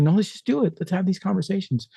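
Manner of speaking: high, keen mock reply; lightly imitating open-minded enthusiasm; mock eager voice. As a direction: sound cheerfully willing. Imitating eager present attitude.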